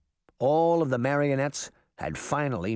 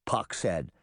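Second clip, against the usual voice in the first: plain reading voice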